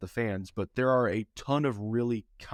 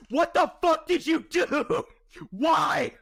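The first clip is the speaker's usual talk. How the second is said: much higher, louder horrified yell; panicked shout; 4 dB louder, slower than usual. Retold or imitated. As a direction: freak out at your friend. Mocked panicked friend.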